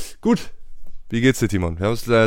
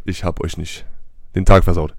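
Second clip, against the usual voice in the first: ordinary talk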